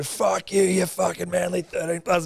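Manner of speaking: mock abuse. Rival fans heckling the podcaster, mock jeering shout. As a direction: heckle him like a rowdy rival fan. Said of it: "they all start, like, turning and abusing me".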